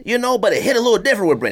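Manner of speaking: normal talking voice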